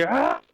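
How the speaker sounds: sudden high mock scream; crazed outburst. Old man snapping over his lost daughter, high mock scream. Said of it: "And he just goes crazy".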